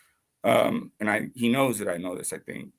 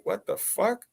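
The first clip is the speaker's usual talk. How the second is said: incredulous disbelief; 9 semitones higher, slower than usual. Retold or imitated. Teller recalls reaction to officer, incredulous. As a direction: let the voice jump up in disbelief.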